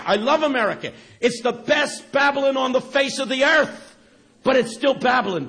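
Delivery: loud, high-pitched preaching cry; preaching shout. Real, not acted. Preacher shouting his love of America to the crowd, loud. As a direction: proclaim it to the whole hall at full voice.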